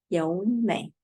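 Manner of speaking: 尤美 with a rising second tone on 尤; tone sandhi 尤美. Shown as a demonstration. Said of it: "So we pronounce this ... third tone to second tone".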